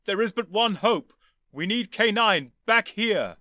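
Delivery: loud, high, slow commanding shout; rally with urgent force. Acted.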